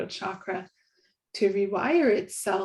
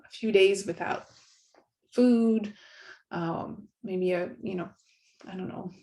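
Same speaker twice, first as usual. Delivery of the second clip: usual speaking voice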